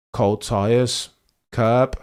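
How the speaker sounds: ordinary talk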